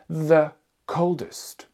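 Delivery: The coldest said very slowly and clearly; teacher modelling The coldest slowly; slow model phrase. Shown as a demonstration. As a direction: draw out The coldest for the class. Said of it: "on va mettre the".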